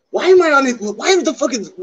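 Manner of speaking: why am I on it, cried out in shock; shocked outburst. Real, not acted. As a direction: blurt it out, stunned and loud. Shocked to find himself discussed.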